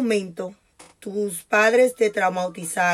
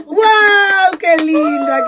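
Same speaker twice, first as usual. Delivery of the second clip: laughing, higher and louder burst of delight; delighted exclamation; 10 semitones higher, 7 dB louder, much slower than usual. Real, not acted. Host welcoming her guest on air, delighted laughing gush.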